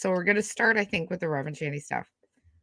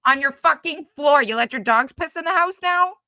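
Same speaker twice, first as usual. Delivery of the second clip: loud, higher, outraged yelling; furious yelling; 6 semitones higher, 6 dB louder than usual. Real, not acted. Yelling at Kaya's video over piss pads, furious. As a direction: blast her, disgusted.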